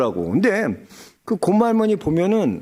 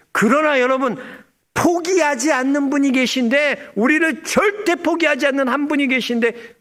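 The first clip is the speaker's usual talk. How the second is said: climbing higher with each line; rising preaching; 9 semitones higher than usual. Real, not acted. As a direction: build toward the promise. Building preaching climax.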